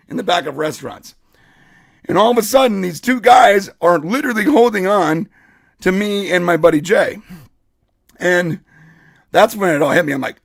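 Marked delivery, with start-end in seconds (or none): none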